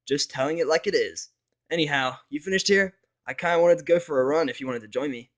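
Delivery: breezy and sure of herself, unbothered; cocky Rainbow. Acted. Rainbow shrugging off the narrator and inviting a run, brash and upbeat.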